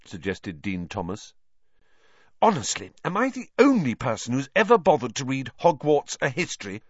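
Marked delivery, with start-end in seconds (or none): 2.4-6.8 higher, exasperated scolding; cross know-it-all, fed up with everyone; crossly. A narrator's reading. Hermione scolding Harry and Ron, crossly. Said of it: "said Hermione crossly".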